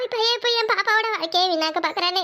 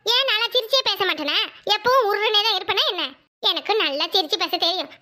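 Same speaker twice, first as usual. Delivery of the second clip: ordinary talk, nothing marked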